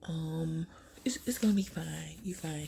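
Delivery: soft whisper, calming; whispering comfort; whispered reassurance. Retold or imitated. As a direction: hush a scared passenger gently. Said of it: "She's like".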